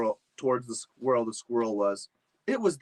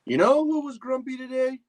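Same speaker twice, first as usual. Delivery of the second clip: high mom voice; 13 semitones higher, 4 dB louder than usual. Acted. Mom voiced in family story.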